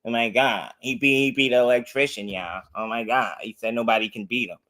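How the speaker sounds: low, hushed mock dismay; mock outrage. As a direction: imitate scandalized critics under your breath. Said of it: "That's selective ass outrage".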